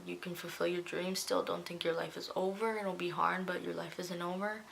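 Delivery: hushed, tender motherly reassurance; gentle encouragement. Retold or imitated. Mother telling her daughter life isn't over, softly encouraging. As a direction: quietly lift a frightened daughter's hopes.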